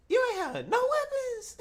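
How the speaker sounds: high incredulous squeal. Real, not acted. Host disbelieving the guest, high incredulous squeal.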